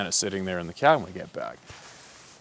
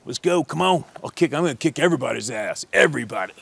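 raised-pitch bully spoiling for a fight; belligerent challenge; 6 semitones higher than usual. Acted. Drunk hunter squaring up to the guides, belligerent challenge. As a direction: call them out, daring anyone to swing. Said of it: "Like this, right?".

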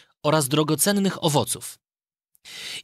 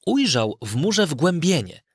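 usual speaking voice

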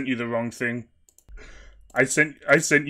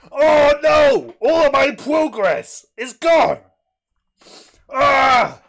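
high, loud anguished wailing; hammed-up shrieks of grief; wailing despair; 13 semitones higher, 8 dB louder, slower than usual. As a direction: scream as if everything is lost. Mock despair over lost Steam account.

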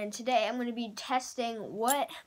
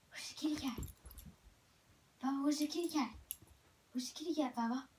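talking to a dog; 2 semitones higher, 7 dB quieter, faster than usual. Real, not acted. Teasing dog about a cat.

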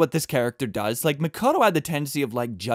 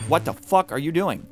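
ordinary talk, nothing marked

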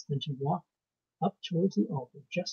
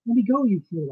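loud, high, frantic demand; gasped protest; 6 semitones higher, 8 dB louder than usual. A narrator's reading. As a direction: cry out desperately to be released. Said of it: "I gasped".

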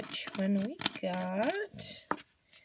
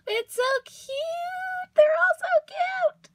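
very high squeal, growing louder; squealing delight; 4 dB louder, slower than usual. Real, not acted. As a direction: gush in a drawn-out high squeal. Gushing over the new poodle toy.

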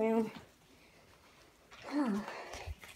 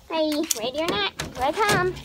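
calling out; 4 semitones higher, 6 dB louder than usual. Real, not acted. Seeker calling out in game.

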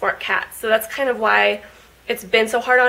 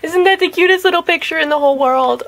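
gushing; 6 semitones higher, 4 dB louder than usual. Real, not acted. Gushing over cat picture.

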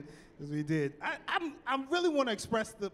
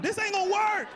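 much higher loud cry of "This ain't gonna work"; mock panicked yell; 12 semitones higher, 4 dB louder than usual. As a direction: yelp it in fake panic. Panicked at gunshots.

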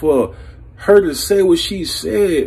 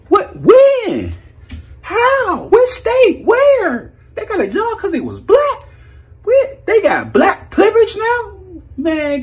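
incredulous; slower than usual. Real, not acted. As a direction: fire off the questions in total disbelief. Incredulous rant about privilege.

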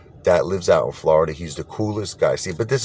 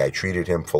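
usual speaking voice

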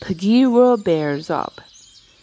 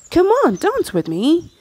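high, louder coaxing call; excited call; 10 semitones higher, 4 dB louder than usual. Acted. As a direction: call out and pull him to dance. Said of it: "he cried".

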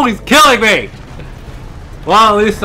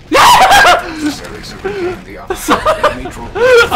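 high disbelieving laugh; 9 semitones higher than usual. Real, not acted. Laughing at own blunder.

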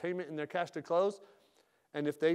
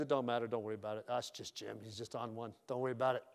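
dismissive staff; 6 semitones lower than usual. Retold or imitated. Staff shrugging off the boss, muttered and dismissive. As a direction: brush it off under your breath. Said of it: "And then they're like".

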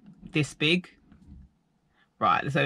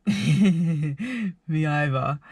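slow giggly reply; much slower than usual. Retold or imitated. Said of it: "she was laughing like".

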